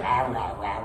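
low mock grumbling growl; dog growl. Preacher imitating the dogs, low growling. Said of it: "they're like".

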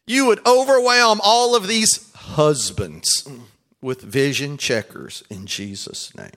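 high, slow, rising prayer call; pastor's prayer climbing high and slow; preaching prayer. Acted. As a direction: lift the congregation in fervent prayer. Leads a prayer.

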